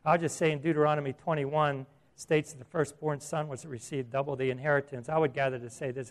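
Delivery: ordinary talk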